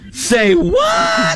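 high shouted disbelief; hammed-up shocked yelp. Shocked retort.

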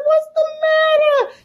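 a pleading, panicked question; alarmed plea. Retold or imitated. Daughter pleading with her upset father, alarmed. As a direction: beg to know what's wrong. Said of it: "I was like".

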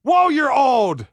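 high loud disbelief; amazed shout. Real, not acted. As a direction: blurt out in shock. Amazed at fifty-year-old player.

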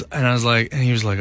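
ordinary delivery

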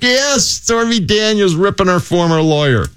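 high, drawn-out mock delight; Steve Greenberg loving it. Colleague loves the Stormy story, imitated gleeful cheer. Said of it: "Steve Greenberg loves this story. Greeny just".

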